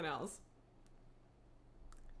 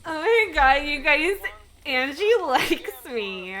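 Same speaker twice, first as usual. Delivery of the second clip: loud, higher-pitched giddy outburst; thrilled excitement; 4 semitones higher, 10 dB louder, slower than usual. Real, not acted. Player telling viewers Angie likes her, thrilled. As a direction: gush it out loudly. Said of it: "I'm so excited to talk to Angie".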